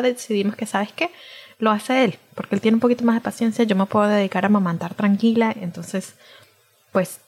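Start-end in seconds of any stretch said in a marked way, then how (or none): none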